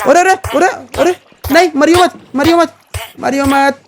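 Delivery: high, frantic cries of no; begging it not to die; panicked pleading. Real, not acted. Pokemon about to faint. (घबराकर ऊँची आवाज़ में गिड़गिड़ाना)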